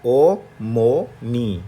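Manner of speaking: ओ मो नी drawn out slowly and loud; slow blended. Shown as a demonstration. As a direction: stretch ओ मो नी. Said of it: "तो ये आमो नहीं है".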